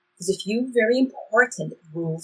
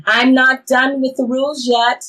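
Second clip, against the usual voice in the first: raised voice across the distance; calling after; 5 semitones higher, 8 dB louder than usual. Acted. Traveler calling after Bree as she walks away, loud. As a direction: call out loud after her as she leaves. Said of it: "The traveler calls after her".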